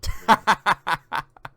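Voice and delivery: loud high burst of laughter. Real, not acted. Host laughing loudly at his friend's joke. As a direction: let out a big loud laugh.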